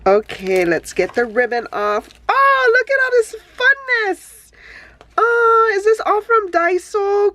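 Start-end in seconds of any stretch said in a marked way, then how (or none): 2.3-7.3 very high, bubbly squeal; high-pitched excited cooing; high gushing delight. Real, not acted. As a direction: coo over every little thing. Gushing over gifts.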